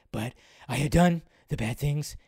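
low hushed raspy confession; Joss Whedon impression. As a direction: mumble the admission low and raspy. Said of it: "that Joss Whedon impression".

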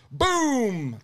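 high, drawn-out falling boom; long boom sliding down in pitch; imitated gunshot boom. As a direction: mimic the blast with a high "boom".

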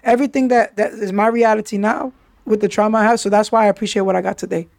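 ordinary talk

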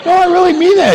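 high, loud emphatic protest; loud insistence. Real, not acted. Pastor insisting to the congregation, loud and high. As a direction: insist it's true. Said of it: "insisting to congregation".

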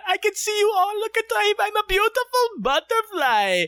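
very high, squeaky cartoon caterpillar; fat caterpillar voice. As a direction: squeal it like a proud chubby bug. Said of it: "But I did that voice".

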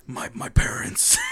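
slow, whispered stammer; hushed mock-traumatized hero; Batman. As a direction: whisper it haltingly, like a haunted hero. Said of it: "does Batman ever have nightmares about".

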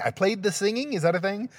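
high-pitched questioning repeat; puzzled self-query. Real, not acted. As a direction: repeat it high, doubting your own wording. Questions his own odd wording.

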